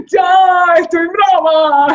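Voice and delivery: a shouted outburst of angry frustration; hysterical anger. Retold or imitated. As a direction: yell it in frustration. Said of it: "Y me ponía histérica".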